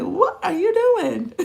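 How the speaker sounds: laughing at their outrage; amused mock shock; mock disbelief. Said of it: "They're like".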